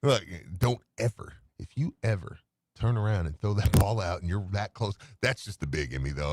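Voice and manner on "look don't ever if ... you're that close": usual speaking voice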